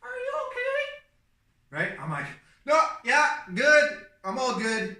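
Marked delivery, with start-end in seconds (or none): 0.0-1.8 high, drawn-out distant call; imitated distant concern. Onlooker checking on the crashed driver, high drawn-out call. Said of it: "I hear this like". 2.6-5.0 high, loud call back; frantic reassurance. Retold or imitated. Driver reassuring a distant onlooker, high frantic call. Answering the onlooker after crash.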